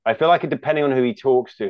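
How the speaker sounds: usual speaking voice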